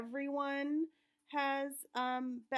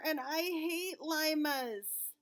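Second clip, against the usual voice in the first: whiny complaint; 3 semitones higher, 4 dB louder, much slower than usual. Acted. Child complaining about dinner.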